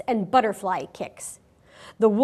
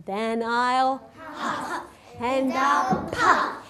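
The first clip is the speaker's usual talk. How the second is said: slow drawn-out wolf threat, growing louder; threatening wolf; 4 semitones higher, 4 dB louder, much slower than usual. Acted. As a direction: stretch each threat slowly. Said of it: "wolf threatens the pig".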